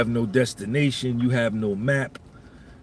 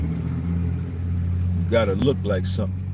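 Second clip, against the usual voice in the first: slow, low emphasis; 7 semitones lower, slower than usual. Acted. Comic preaching to the men in the audience, slow and low. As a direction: drag it out low and slow, each word weighted.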